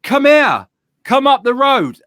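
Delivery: high, loud beckoning shout; calling out. Retold or imitated. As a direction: holler at someone to drive up the road. Retold calling drivers up the hill.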